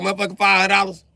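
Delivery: loud, fast, high shocked yell at the price; shocked outburst. Retold or imitated. Reading a price online, a fast shocked yell. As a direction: blurt it out fast like you can't believe it. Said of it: "you be like".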